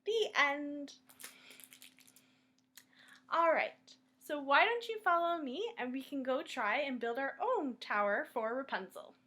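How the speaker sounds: ordinary delivery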